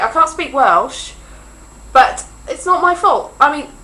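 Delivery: ordinary talk, nothing marked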